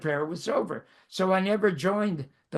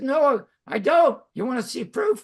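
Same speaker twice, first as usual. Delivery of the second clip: higher, louder insistent retold protest; 8 semitones higher, 5 dB louder than usual. Twelve-year-old to friend's mother about his absent mother, insistent retold protest. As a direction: argue your case emphatically, pitched well up.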